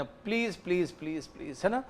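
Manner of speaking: a servant sweetly begging his boss; soft pleading. Acted. Servant coaxing his owner for a raise, softly pleading. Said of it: "इस बार थोड़ा प्यारे से बात कर लेते हैं".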